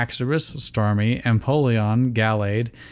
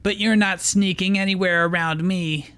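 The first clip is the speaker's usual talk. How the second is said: taunting; 8 semitones higher than usual. Acted. Ash taunting Max.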